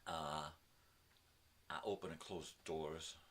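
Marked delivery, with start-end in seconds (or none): none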